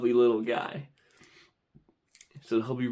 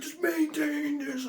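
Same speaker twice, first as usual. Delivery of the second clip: slow, high, softer squeal; strained self-control; 14 semitones higher, 5 dB quieter, slower than usual. Acted. Bidder holding himself back, strained squeal. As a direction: drag it out high, barely holding on.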